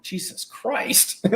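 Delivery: slow, breathy, high disbelief; exasperated. Retold or imitated. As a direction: whisper it in disbelief. Reacting to injuries at shows.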